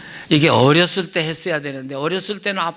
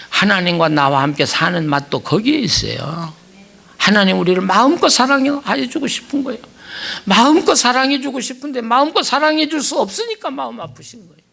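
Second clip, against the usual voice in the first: ordinary talk, nothing marked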